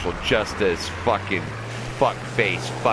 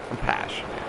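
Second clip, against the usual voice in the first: breathy whisper, much lower and softer; 11 semitones lower, 6 dB quieter than usual. Real, not acted. Streamer repeating to viewers that he is passionate, whispered. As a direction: drop it to a whisper. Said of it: "I'm passionate right now".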